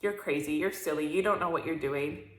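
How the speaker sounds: low, quiet belittling inner voice; inner critic voice. Retold or imitated. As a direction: put yourself down like the voice in your head. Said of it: "that voice will say".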